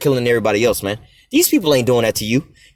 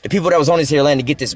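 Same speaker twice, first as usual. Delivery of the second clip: ordinary talk, nothing marked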